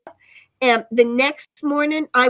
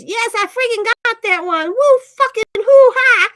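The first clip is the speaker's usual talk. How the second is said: excited cheer; 10 semitones higher, 9 dB louder than usual. Acted. Reading an excited chat message.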